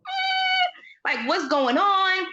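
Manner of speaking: high, slow bewildered eh; mock confusion; puzzled look voiced. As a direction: imitate a confused character who can't piece it together. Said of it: "she kept giving the face".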